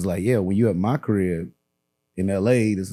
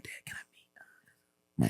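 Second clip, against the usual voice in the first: put-on hushed child's whisper to Dad; 15 dB quieter, much slower than usual. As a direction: whisper it, asking a discreet favor. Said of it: "they'll whisper".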